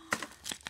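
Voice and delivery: short breathy gasp of surprise; delighted gasp. Real, not acted. Opening a friend's gift, delighted gasp.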